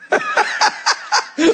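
a bright peal of laughter; high burst of laughter. Real, not acted. Preacher laughs at own point.

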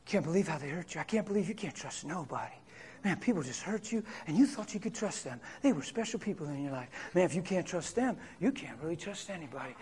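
soft, low, sly murmur; enemy's whisper. Acted. Preacher acting the enemy whispering to the hurt, low and hushed. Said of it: "He'll say, he'll say".

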